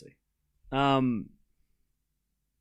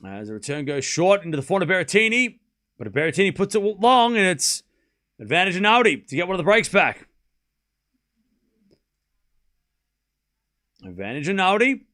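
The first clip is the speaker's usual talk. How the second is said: quick running rally commentary; live play-by-play; 4 semitones higher, 6 dB louder than usual. Acted. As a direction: call each shot as it happens.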